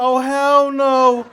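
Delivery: drawn-out, loud, horrified; dramatic disbelief at the mirror; loud horrified oh hell no. Retold or imitated. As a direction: belt it out in mock horror. Horrified at his own body.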